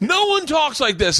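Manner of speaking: very high mock exasperation; incredulous protest. Imitating actors objecting to a script, incredulous protest. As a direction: squeal the complaint, hammed up.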